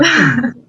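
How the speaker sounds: a short loud laugh at his own joke; burst of laughter. Real, not acted. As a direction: laugh out loud after the teasing. Profesor bromea con Valentina. (carcajada breve y fuerte tras la broma)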